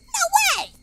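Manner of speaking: no way, squeal it; shocked. Real, not acted. Streamer squealing in disbelief at chat's fact.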